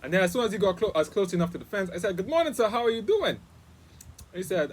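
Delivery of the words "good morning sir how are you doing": cheery retold hello, pitched high; jovial greeting. Guest greets the neighbor's husband at the fence, jovial. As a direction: greet him jovially with a raised, lilting voice. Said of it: "jovially said good morning to him".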